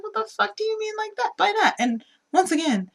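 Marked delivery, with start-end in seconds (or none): 0.0-1.8 high-pitched offended retort; acted-out squeal of offence; mock indignation. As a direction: imitate an affronted friend, pitch shooting way up. Voicing Gina's offended reply to friend.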